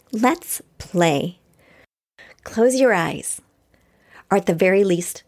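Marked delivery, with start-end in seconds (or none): none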